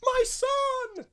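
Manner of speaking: very high, loud posh mother. Retold or imitated. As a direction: cry it out in a hammed-up posh voice. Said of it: "Posh voice, not, not an American voice".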